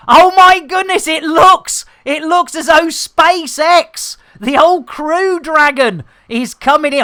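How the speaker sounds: hammed-up astonishment, pitched well up; mock-excited commentary. Mock commentary on approaching ship.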